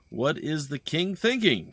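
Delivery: lightly voiced game characters, a touch urgent; dialogue read-through. Streamer reading the soldiers' dialogue to viewers, lightly voiced. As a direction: read the lines like a cutscene, little acting.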